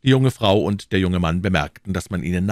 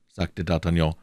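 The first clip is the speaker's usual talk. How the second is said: plain reading voice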